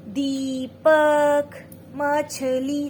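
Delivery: बच्चे को सिखाते हुए बहुत धीरे, खींचकर; slow teaching drawl. Shown as a demonstration. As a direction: stretch every syllable for a child. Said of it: "बच्चे से पूछो आप कि".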